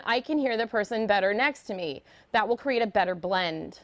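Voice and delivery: ordinary talk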